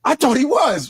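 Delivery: usual speaking voice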